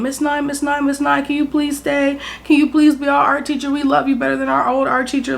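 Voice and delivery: high-pitched mock kids begging; imitating pleading kids. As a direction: put on a high kid voice, clamouring for a favorite teacher. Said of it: "everybody was just yelling my name".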